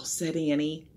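ordinary delivery

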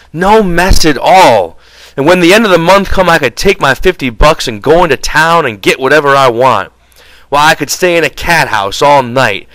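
worked-up angry griping; angry rant. A narrator's reading. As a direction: rant on about all you could have. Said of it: "looked over the fire at the angry George".

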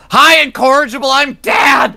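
sudden full-volume yell, high. Pretend. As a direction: yell it straight into her ear. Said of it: "Just yells that in max volume in her ear".

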